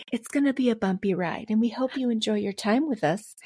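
airline announcement voice; flight attendant voice. Pretend. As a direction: welcome aboard, cabin crew style. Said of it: "I'm gonna say it in the voice".

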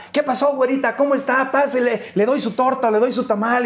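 a hint of a friendly vendor calling to customers; street vendor. Street vendor hawking food. (vendedora callejera dicharachera, voz más aguda)

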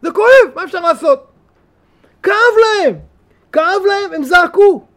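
fervent sermon climax; impassioned cry. Real, not acted. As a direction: cry it out with full force. Preaching peak about crying out.

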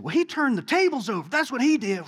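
high, hammed-up righteous indignation; comic table-flipping fury; mock outrage. Acts out absurd table-flipping zeal.

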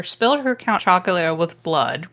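ordinary talk

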